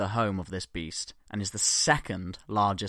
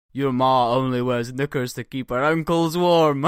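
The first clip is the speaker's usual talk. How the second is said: put-on Scottish accent; 3 semitones higher, 7 dB louder than usual. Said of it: "read out in my best Scottish accent".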